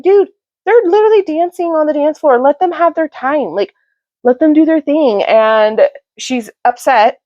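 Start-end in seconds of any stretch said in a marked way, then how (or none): 0.0-5.2 high-pitched, exasperated telling-off; mock exasperation, pitched up; exasperated retort. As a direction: act out an annoyed friend sticking up for others. Acting an annoyed retold quarrel.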